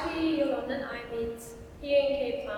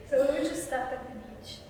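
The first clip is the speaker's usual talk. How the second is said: usual speaking voice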